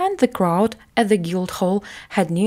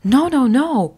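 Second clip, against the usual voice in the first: higher, louder frantic no; crying out; 6 semitones higher, 5 dB louder than usual. A narrator's reading. Boy refusing to go with Canty, frantic cry. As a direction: refuse him in a rising panic. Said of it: "cried Edward".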